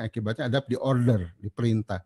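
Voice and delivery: ordinary delivery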